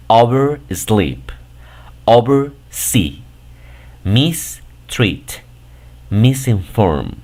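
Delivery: slow demo of oversleep, mistreat, microwave, subtitle, each part drawn out and fully voiced; prefix and word distinct. Shown as a demonstration. Teacher models prefixed words for learners, slow part-by-part pronunciation of oversleep through automatic. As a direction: stretch oversee, because, tonight, replace one at a time, falling at each end. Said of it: "como si fuera de manera individual".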